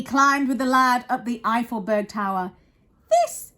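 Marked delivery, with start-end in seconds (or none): 3.1-3.5 high, loud little mayor; mayor crying out. Acted. Mayor rallying the lad, high loud cry. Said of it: "cried the mayor".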